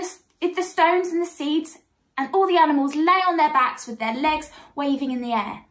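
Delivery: ordinary delivery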